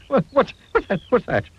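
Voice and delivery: very high, breathless panic; terrified shriek. A narrator's reading. Mister Jelly startled by a twig, shrieking. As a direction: squeal at the snap. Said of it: "he shrieked".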